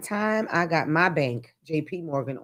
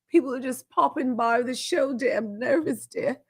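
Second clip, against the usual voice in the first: put-on English accent; 6 semitones higher than usual. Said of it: "get my English accent there".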